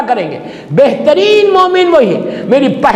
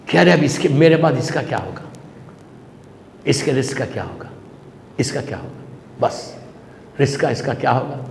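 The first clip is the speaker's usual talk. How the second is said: much lower and softer, fading to a breathy near-whisper; anxious inner thought; 13 semitones lower, 10 dB quieter than usual. Acted. Moses fretting over his daughter's future, hushed and fading. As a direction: let the worry sink into a hushed murmur.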